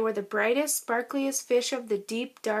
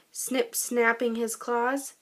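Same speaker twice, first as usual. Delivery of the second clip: ordinary delivery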